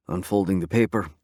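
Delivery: ordinary delivery, nothing marked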